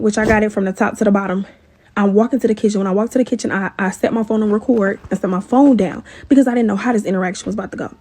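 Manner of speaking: ordinary delivery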